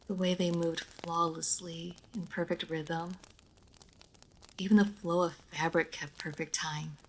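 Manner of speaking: normal talking voice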